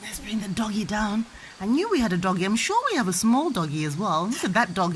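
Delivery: usual speaking voice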